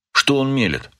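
grumbling. A narrator's reading. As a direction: grumble in mild disbelief. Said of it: "проворчал сзади один из этих ребят". (ворчливо, с лёгким недоверием)

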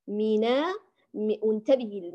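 ordinary delivery

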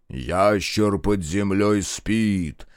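slow, drawn-out ritual chant; trained orator voice. A narrator's reading. Minkult leads the ritual, stately intoning. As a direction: intone it slowly like a ceremony leader. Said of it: "заговорил поставленным голосом".

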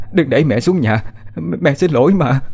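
a mother begging, voice lifting in tears; tearful begging. Acted. Terrified mother begs her daughter not to push her, tearfully pleading. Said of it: "Bà vừa khóc vừa van xin".

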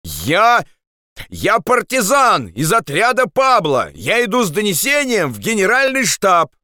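high-pitched insistent call; shouted explanation. Acted. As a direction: call it up to the sentries. Andres shouts his identity upward. (настойчивый крик)

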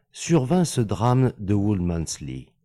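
ordinary delivery

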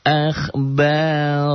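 slow, high chanted tilawah; Quran recitation. Shown as a demonstration. Teacher reciting the verse for the class, slow chant. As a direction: draw the words out melodically.

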